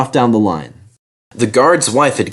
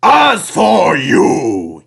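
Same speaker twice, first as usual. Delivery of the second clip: slow, higher, booming rebuke; thundering; 4 semitones higher, 3 dB louder, much slower than usual. A narrator's reading. Fat controller rounds on the big engines, thundering. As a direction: bring the hammer down slowly. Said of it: "he thundered".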